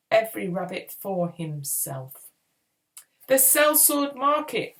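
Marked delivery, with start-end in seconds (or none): 3.3-4.8 high, loud urgent call; yelling. A narrator's reading. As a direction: call it out loudly over the chaos. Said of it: "Paz yelled".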